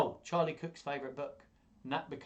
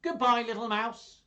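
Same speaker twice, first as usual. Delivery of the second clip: much higher, louder flustered farewell; hasty goodbye; 11 semitones higher, 10 dB louder than usual. Acted. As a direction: flee while calling goodbye. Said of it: "do all the voices".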